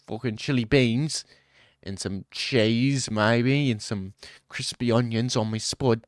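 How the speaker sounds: put-on UK street accent ordering food; hammed-up British chav accent; mock British accent. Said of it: "Y'all got the worst accent. U K, bro".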